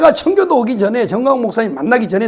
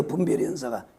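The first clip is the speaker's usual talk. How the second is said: soft echo; 9 semitones lower, 11 dB quieter than usual. Real, not acted. Quiet answer echo.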